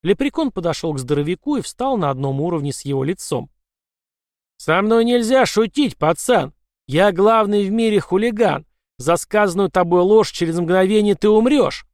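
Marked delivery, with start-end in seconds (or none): 4.6-11.9 menacing leprechaun. Acted. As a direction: threaten him from above. Leprechaun threatens Grandi.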